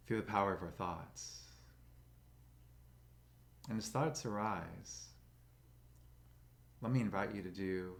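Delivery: slow, calm meditation guide with long pauses; soothing, even voice, leaving space for breath; guided meditation. Acted. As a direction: guide them gently into stillness, unhurried.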